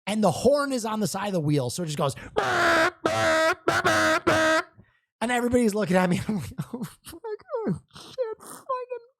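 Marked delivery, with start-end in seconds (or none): 2.3-4.7 high squeaky burp noises; horn imitation. Imitating the Tesla horn going off, high squeaks. 6.7-9.1 panicked squeal. Retold or imitated. Everybody staring at him, high strangled cursing.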